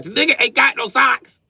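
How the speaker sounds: high, loud scolding yell; dad yelling at daughters to share socks. Retold or imitated. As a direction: shout them down for teasing their brother. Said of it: "I be like".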